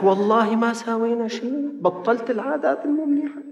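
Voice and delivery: high frantic swearing he's done nothing; panicked denial. Retold or imitated. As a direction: plead your innocence in a rising panic. Man swears innocence in alarm.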